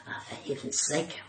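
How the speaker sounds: exasperated mutter. Retold or imitated. Annoyed ranger, low mutter.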